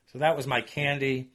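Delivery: usual speaking voice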